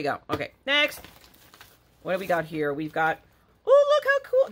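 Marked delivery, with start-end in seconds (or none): 3.6-4.5 high, loud squeal of delight. Real, not acted. To viewers unboxing a surprise, delighted squeal. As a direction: burst out with joy at the find.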